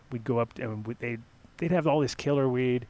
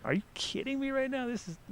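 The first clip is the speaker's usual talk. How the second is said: disbelief; 12 semitones higher, 3 dB quieter than usual. Retold or imitated. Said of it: "unwrap it and be like".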